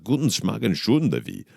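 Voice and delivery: badly faked German accent; comic made-up German, thick accent. Shown as a demonstration. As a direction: put on a clumsy mock German. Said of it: "I can't do the German".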